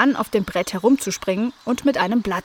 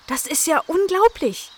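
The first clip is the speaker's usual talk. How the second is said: higher, amazed exclamation; amazed dog; 8 semitones higher than usual. A narrator's reading. Nala amazed at the matching cats, higher. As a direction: marvel at the twins. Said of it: "sagte Nala Nasebraun".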